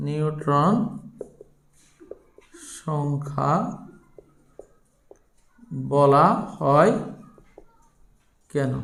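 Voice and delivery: low-pitched, neutron, সংখ্যা, বলা হয়, কেন parted by long pauses; slow dictation. Real, not acted. Teacher giving students an exam question to copy, slow dictation.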